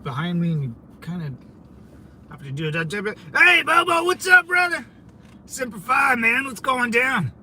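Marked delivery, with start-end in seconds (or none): 3.4-7.3 high, loud friendly hail; greeting a Marine buddy from the car, loud and high; calling out. Real, not acted. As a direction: shout a greeting across the street.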